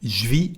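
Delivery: "Je vis" fused into one syllable "zhvee"; spoken French "Je vis", said "j'vis"; contraction. Shown as a demonstration. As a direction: drop the vowel of "Je" in "Je vis". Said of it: "A second contraction here".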